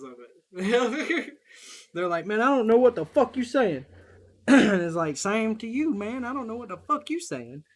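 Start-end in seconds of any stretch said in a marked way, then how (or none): 2.3-3.9 loud, higher-pitched mock exasperation; exasperated relatives. Family voiced in exasperated exchange. 5.2-7.6 higher-pitched comeback, loud then easing; mock retort. His retort to family, acted out.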